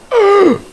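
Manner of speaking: loud startled high gasp; sharp high gasp. Real, not acted. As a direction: gasp like you just spotted danger. Sees creature.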